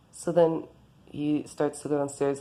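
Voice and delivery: ordinary delivery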